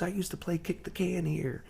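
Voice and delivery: high-pitched, softened voice of nostalgic wonder. Retold or imitated. Rock star showing the driver where he played, excited. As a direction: imitate someone thrilled by a memory, pitched well up. Star revisits childhood street.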